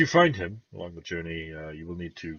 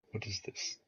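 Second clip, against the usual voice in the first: much softer, quiet question; hushed puzzlement; 3 semitones higher, 13 dB quieter than usual. Acted. Lord studying the dragon egg piece, hushed and puzzled. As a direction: ask it under your breath, puzzled.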